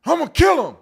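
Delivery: an enraged father losing it; angry father. Retold or imitated. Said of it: "He said".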